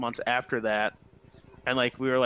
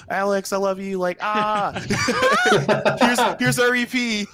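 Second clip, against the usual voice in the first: higher and louder, laughing with joy; excited fan squeal; gushing excitement; 8 semitones higher, 7 dB louder than usual. Retold or imitated. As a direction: gush like a starstruck fan. Fangirling reply retold.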